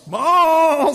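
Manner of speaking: high, loud, drawn-out bellow; bawling calf. A narrator's reading. Star the calf as the sled bounces, bawling. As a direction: bawl like a startled calf. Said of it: "said Star".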